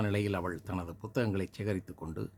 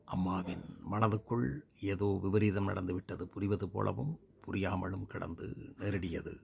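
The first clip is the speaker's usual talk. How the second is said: ordinary delivery, nothing marked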